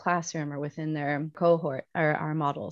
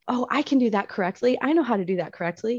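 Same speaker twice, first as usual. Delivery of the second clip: higher, fast eager boast; eager dog voice; 6 semitones higher, 3 dB louder, faster than usual. Retold or imitated. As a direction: play the keen dog jumping in. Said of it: "doing it as if it were a dog".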